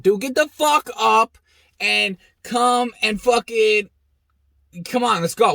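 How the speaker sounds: loud, slow, pleading command; urgent yell. Retold or imitated. Hurrying buddy away from the trucks, loud urgent yell. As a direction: yell to drag him out of there.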